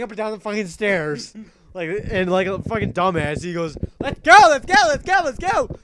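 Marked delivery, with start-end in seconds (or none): 4.0-5.8 high, loud repeated urging; mock frantic shouting. Fat Man rushing everyone down the stairs, exaggerated frantic yell. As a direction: yell it over and over in panic.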